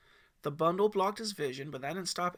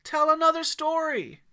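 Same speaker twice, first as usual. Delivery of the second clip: put-on loud high kid's yell; 13 semitones higher, 13 dB louder than usual. A narrator's reading. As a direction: yell it out eagerly. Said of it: "Timmy yelled".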